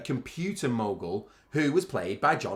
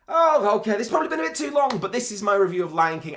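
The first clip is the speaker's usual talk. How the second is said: hurried excitement; 10 semitones higher, 7 dB louder than usual. Real, not acted. Breaks off, rushes to wrap up.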